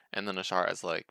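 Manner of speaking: ordinary talk, nothing marked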